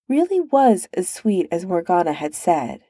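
plain reading voice

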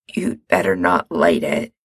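a touch of a shaky low warning; shakily. Acted. Sick Mikey warns Gerard, mildly shaky. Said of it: "he says shakily".

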